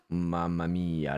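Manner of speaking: ordinary talk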